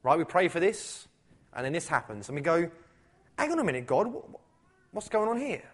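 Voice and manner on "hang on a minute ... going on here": high-pitched, mock-baffled stammer; acted-out puzzled objection, pitched high; bewildered protest. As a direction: protest to God, voice up in confusion.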